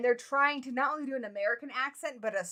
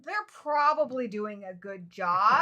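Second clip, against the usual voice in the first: mock studio executives; 3 semitones higher, 3 dB louder than usual. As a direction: imitate gleeful producers hatching a careless plan. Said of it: "so they're like".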